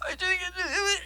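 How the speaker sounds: high whiny mock cry; mock sobbing sister. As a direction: cry it out like a little sister having a tantrum. Crying sister voiced.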